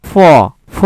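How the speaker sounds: "ফ" as a light English f sound; half ফ. Shown as a demonstration. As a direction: demonstrate "ফ" as f, not aspirated ph. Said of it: "অর্ধেক ফ এর উচ্চারণ হচ্ছে".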